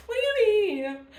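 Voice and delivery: high, loud what do you mean; a touch of tearful surprise; emotional surprise. Retold or imitated. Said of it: "she makes me so emotional".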